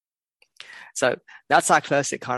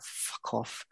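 mock whispered swear under the breath; whispered curse; 2 semitones lower, 13 dB quieter than usual. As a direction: hiss it to yourself in disbelief. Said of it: "you're like".

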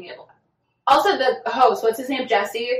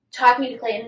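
ordinary talk, nothing marked

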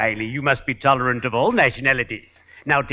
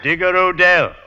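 louder, higher name call; announcing name; 5 semitones higher, 5 dB louder than usual. Pretend. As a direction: proclaim the name. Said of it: "confront him and say".

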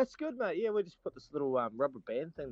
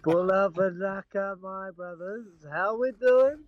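excited greeting; 6 semitones higher, 4 dB louder, slower than usual. Real, not acted. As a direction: greet the brothers big and slow. Hearty greeting to the lads.

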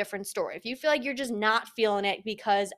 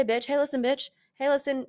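tough self-talk; 4 semitones higher than usual. Retold or imitated. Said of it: "the conversation I like to have with myself".

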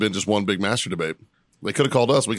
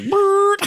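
high buzzing pump sound; pump noise; 5 dB louder than usual. Retold or imitated. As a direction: mimic a pneumatic pump. Imitating pneumatic pump noise.

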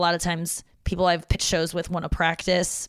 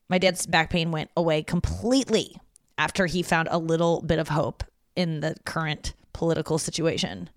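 normal talking voice

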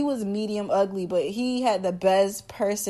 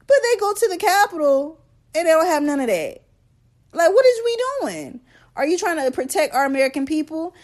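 high-pitched, louder incredulous rant; outraged questioning, voice raised high; indignant disbelief; 7 semitones higher, 4 dB louder than usual. Real, not acted. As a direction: demand an explanation, pitch jumping up. Indignant rant about unequal National Guard response.

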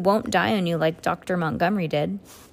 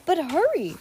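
high, pressing plea; urgent cry; 11 semitones higher than usual. A narrator's reading. Klaus rushing Mister Poe out the door, urgent cry. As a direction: urge them on with a high voice. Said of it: "Klaus cried".

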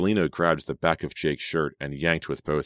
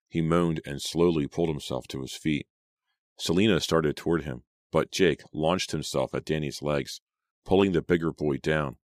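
ordinary delivery, nothing marked

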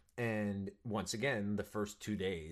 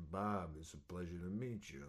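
low, soft, smooth composed greeting; hushed, cool-guy introduction; suave planned greeting; 3 semitones lower, 6 dB quieter than usual. Retold or imitated. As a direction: play it cool, lower and softer. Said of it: "I was gonna be like".

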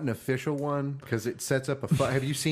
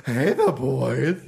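Snagglepuss voice; 7 semitones higher, 8 dB louder than usual. Pretend. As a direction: ham it up like a cartoon lion. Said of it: "talk like Snagglepuss".

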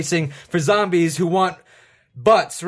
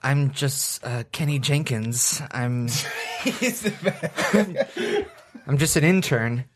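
timid intern; 4 semitones lower, 6 dB quieter, slower than usual. Pretend. Timid intern character introduces himself.

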